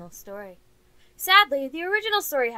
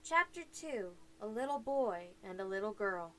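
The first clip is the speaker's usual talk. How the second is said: story narration; 4 dB quieter than usual. A narrator's reading. Reads the fairy tale aloud.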